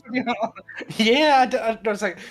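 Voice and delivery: high-pitched laughing confession; laughing while talking. Real, not acted. Tells awkward story while laughing.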